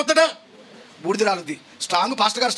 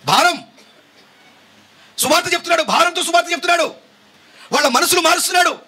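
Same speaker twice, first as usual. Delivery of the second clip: high, raised preaching voice; loud, burdened proclamation; 6 semitones higher than usual. Shown as a demonstration. Preacher showing why Paul spoke out loudly, loud burdened proclamation. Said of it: "బిగ్గరగా ఎందుకు చెప్తున్నాడు".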